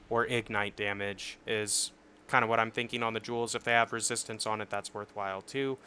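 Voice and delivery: ordinary delivery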